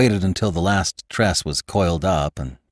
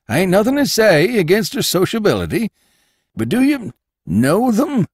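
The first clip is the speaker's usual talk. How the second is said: higher, louder gossipy landlord voice; Jacobus, landlord; 5 dB louder than usual. Acted. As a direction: play the nosy old landlord, pitched up.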